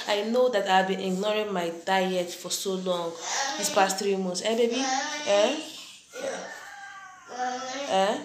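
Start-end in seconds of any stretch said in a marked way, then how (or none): 4.4-8.2 calling out. Real, not acted. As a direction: call across the house. Calling to child offscreen.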